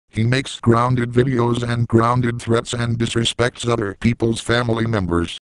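ordinary talk, nothing marked

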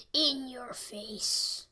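slow, hushed whisper; whispered gloat. Real, not acted. Gamer whispering the taunt back at his opponent. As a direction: breathe the taunt slowly.

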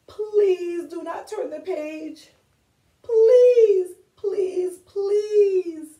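high, pleading monster voice rising to a crying shout; pleading Grover. Acted. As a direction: beg desperately like a frightened furry monster. Grover begs not to turn page.

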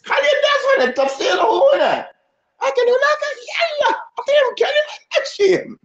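advising friend. Retold or imitated. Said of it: "واحد أخذني قال".

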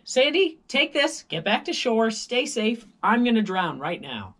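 high, loud dramatic orders; hammed-up brave farewell; mock heroic shout. Swimmer to Sandy before going under, high hammed-up shout.

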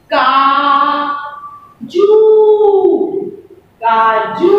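काजू with the final ऊ drawn out slowly; काजू, long ऊ held. Shown as a demonstration. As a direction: stretch the ऊ at the end of काजू. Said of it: "sound से समझ में आता है".

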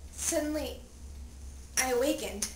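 ordinary delivery, nothing marked

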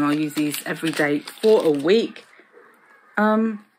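ordinary talk

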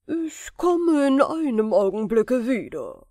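loud, eager genie voice; a servant promising to be quick; a loud, ready genie. A narrator's reading. Genie answers Aladin.

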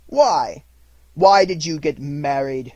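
loud, slow, incredulous question; grumpy uncle's challenge; cross demand. A narrator's reading. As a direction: demand it with disdain. Scrooge demands an explanation.

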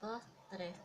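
quiet counting. Real, not acted. Embroidery teacher counting her own thread wraps, soft murmur.

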